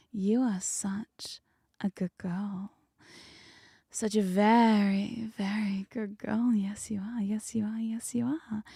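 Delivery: slow, half-whispered cooing praise. Pretend. Host praising Nami as a good girl, whispered coo. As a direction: coo it slowly and breathily, like praising a pet.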